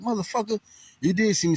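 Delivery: high, incredulous mock accusation; retold outburst. Retelling calling out the driver, high incredulous accusation. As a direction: call out the lie, voice jumping up high. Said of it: "I was like".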